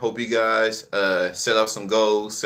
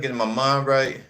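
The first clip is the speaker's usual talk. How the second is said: normal talking voice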